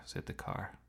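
ordinary delivery

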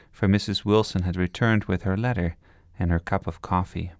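plain reading voice

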